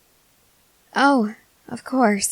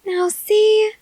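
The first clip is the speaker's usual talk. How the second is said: high, louder singsong; pleased triumph; 12 semitones higher, 5 dB louder than usual. Acted. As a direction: crow it sweetly, pitch held high. Gloating after getting the confession.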